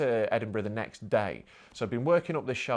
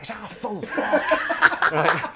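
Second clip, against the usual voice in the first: laughing; 7 semitones higher, 9 dB louder than usual. Retold or imitated. Retelling panic over fuel tank.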